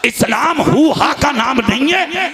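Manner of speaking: forceful, rolling sermon cadence; fiery preaching. Real, not acted. Preacher telling congregation Islam is not noise, fiery preaching. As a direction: drive each denial home to the crowd.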